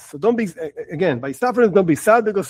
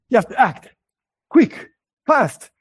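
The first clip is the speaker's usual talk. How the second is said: urgent; 5 semitones higher, 3 dB louder than usual. Shown as a demonstration. Urging instant action to save child.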